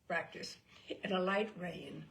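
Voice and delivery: ordinary delivery, nothing marked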